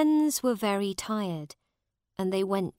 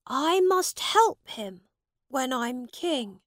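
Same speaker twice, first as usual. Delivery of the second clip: a touch higher, lightly voiced young prince; lightly raised youthful voice; boy king resolving; 6 semitones higher, 3 dB louder than usual. A narrator's reading. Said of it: "thought Edward".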